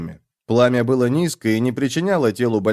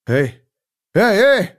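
higher, louder alarmed cry; panicked calling; 8 semitones higher, 5 dB louder, slower than usual. A narrator's reading. Tied-up man calls out as the fire burns, panicked. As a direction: call out in rising panic. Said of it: "охватила паника человека".

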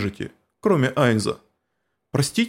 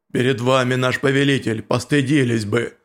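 stern insect warrior; 3 semitones lower than usual. Acted. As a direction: rebuke them low and heavy. Cocytus rebukes, low voice.

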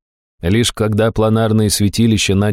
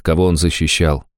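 ordinary delivery

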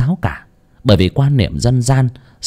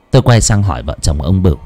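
plain reading voice

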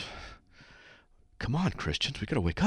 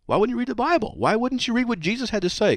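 much higher, louder disbelieving questions; astonished protest, pitched way up; incredulous; 5 dB louder than usual. Retold or imitated. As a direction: retell it as a baffled, high-pitched challenge. Retold incredulous challenge to the student.

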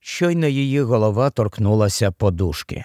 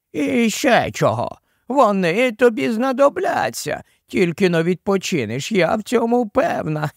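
high-pitched, cajoling nanny voice with a chuckle; old nanny; 6 semitones higher than usual. A narrator's reading. Giant nanny brushing off Jill's complaints, high fussy cajoling. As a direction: coo and fuss in a raised character voice. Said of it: "то була няня".

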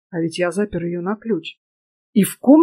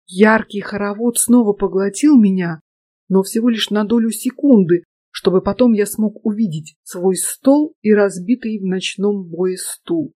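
usual speaking voice

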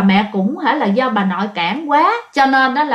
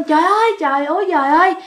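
retold frightened cry; 4 semitones higher, faster than usual. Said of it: "nội mới la lên".

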